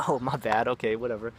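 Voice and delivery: laughing it off. Retold or imitated. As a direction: brush off a mistake breezily. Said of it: "would just laugh it off".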